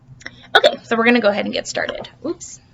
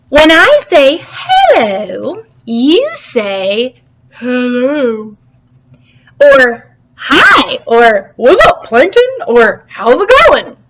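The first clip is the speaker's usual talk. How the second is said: loud coaching; 3 semitones higher, 6 dB louder, much slower than usual. Shown as a demonstration. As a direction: spell it out loudly for the mussel. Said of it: "give this another whirl".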